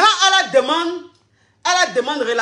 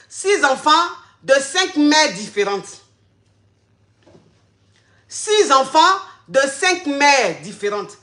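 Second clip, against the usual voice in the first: each word spelled out slowly; slow emphasis; slower than usual. Real, not acted. Stressing the guy's six children, slow emphasis. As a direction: lay every word down heavy and slow. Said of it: "repeats slowly for emphasis".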